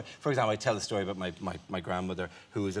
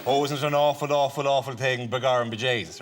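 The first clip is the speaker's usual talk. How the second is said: hammed-up Irish blarney, raised voice; stage-Irish charm; 5 dB louder than usual. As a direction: lay on mock Irish sympathy. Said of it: "no amount of Irish charm".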